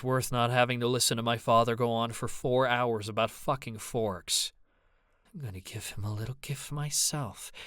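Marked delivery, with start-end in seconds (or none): 5.3-7.5 soft, deadly mutter. Acted. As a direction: menace under the breath. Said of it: "Ava muttered".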